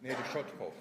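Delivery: "Nedoshotko" said as ne-do-SHOT-ko, with sh and a final o; correct name pronunciation. Shown as a demonstration. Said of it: "How do you pronounce it?".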